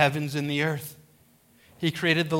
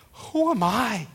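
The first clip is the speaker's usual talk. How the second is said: slow, raised wondering question; humbled questioning; 5 semitones higher, slower than usual. Acted. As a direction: ask it slowly, higher, as overwhelmed Moses. Moses questioning God at the bush.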